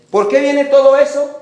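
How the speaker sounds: shouted rhetorical question to the congregation; loud question. Real, not acted. Preacher booming the question at the church. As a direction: call it out loud and high.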